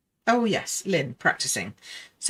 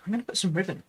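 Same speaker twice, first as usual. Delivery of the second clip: ordinary talk, nothing marked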